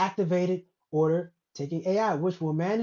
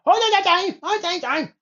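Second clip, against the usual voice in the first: loud, much higher mock Chinese chatter; mock Chinese accent; 11 semitones higher, 6 dB louder than usual. Imitating how Chinatown workers relay orders, loud mock Chinese accent. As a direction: shout it high in a caricature Chinese accent. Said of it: "you know how they do it".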